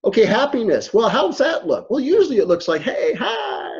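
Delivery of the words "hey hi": high, bright mock-cheerful hello; cheery greeting. Lecturer playing a happy employee greeting people, high and cheery. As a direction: act like a chipper coworker saying hi.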